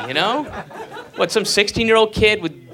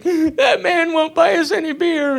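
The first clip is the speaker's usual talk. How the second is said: put-on crying kids; 12 semitones higher than usual. Kids crying voiced in story.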